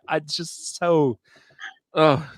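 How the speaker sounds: ordinary delivery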